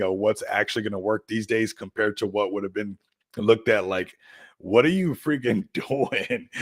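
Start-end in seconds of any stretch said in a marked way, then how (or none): 4.6-6.5 slow, higher-pitched incredulous question; drawn-out mock bewilderment breaking into a laugh; mock disbelief. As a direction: imitate someone baffled, stretching the words. Imagined old-days reaction to streamer.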